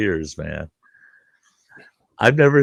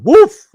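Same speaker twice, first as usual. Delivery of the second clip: high, loud burst of a whoosh; imitated fire whoosh; 14 dB louder than usual. Joking about the fuzzy friend catching fire, loud whoosh. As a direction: act out hair going up in flames.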